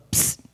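short hissed attention signal; a quick "psst" across a crowd; imitated psst. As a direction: discreet voiceless psst. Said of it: "he'll go".

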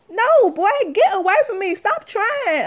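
loud, high, fed-up shout at a pushy guy; exasperated yell. Retold or imitated. Mom yelling at the pushy stranger, fed up and laughing. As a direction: blast him away, half laughing. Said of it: "But I didn't say that".

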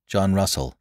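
plain reading voice

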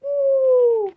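high gleeful woohoo; excited whoop. Acted. Freed spirit cheering, excited whoop. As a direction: cheer like you're flying free.

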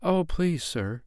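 put-on high little girl, a touch timid. A narrator's reading. Lame girl asking the little man, timidly. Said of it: "answered little Nanny timidly".

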